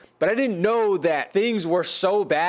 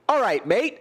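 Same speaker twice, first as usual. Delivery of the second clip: mock British accent; 6 semitones higher than usual. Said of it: "why are they Australian?".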